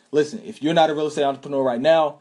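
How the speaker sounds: ordinary delivery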